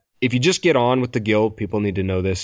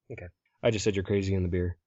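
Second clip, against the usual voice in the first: usual speaking voice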